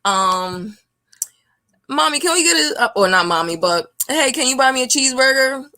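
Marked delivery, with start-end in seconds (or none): 1.9-2.8 put-on much higher childlike ask; child asking. Child asking mommy for McDonald's, high. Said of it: "For example". 4.1-5.6 put-on higher, fast kid plea; child begging. Kid asking for a cheeseburger, higher, quick. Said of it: "For example".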